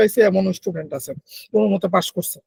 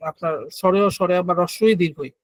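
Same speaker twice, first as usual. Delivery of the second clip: ordinary talk, nothing marked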